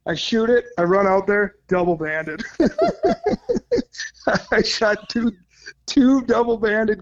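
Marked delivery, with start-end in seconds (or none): 2.5-4.5 giddy laugh jumping way up; high delighted laugh. Real, not acted. Guide laughing at his luck, giddy high laugh. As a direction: burst out laughing in disbelief. Amazed at second double band.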